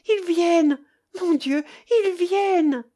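high, loud cry of dread; panicked child's voice, pitched high; anguished cry. A narrator's reading. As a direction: cry out in anguish as a frightened boy. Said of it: "cria-t-il d'une voix angoissée".

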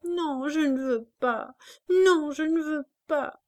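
put-on crying child stammering refusal; tearful stammer, rising; stammering sob. A narrator's reading. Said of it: "bégayait l'enfant".

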